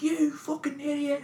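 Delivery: high, slow exasperated shout; mock angry cop. Retelling the cop yelling at the drunk, mock angry. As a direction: bark it like a fed-up cop. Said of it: "she's like".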